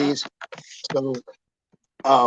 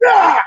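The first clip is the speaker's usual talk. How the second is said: high, loud gimmick yell; mock battle roar; 8 dB louder than usual. Man throwing coins at the window, loud high yell. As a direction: roar out like he's in character.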